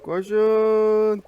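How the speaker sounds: loud, high shout to run; shouted order. Real, not acted. Gamer shouting at teammates to run, loud and high. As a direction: yell at them to run. Urging others in the game.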